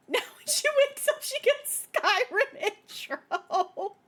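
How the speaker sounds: high giggly quip. Real, not acted.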